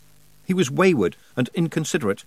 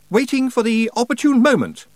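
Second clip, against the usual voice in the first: put-on much higher, louder haughty boy; 12 semitones higher, 5 dB louder than usual. A narrator's reading. Roland answers Rob haughtily, much higher boyish voice. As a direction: stiff and superior. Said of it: "said Roland haughtily".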